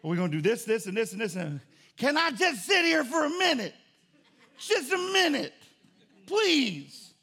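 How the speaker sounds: tired husband begging his wife; exasperated husband plea. Retold or imitated. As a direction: beg for a break, laughing. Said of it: "she'll have her honey to-do list".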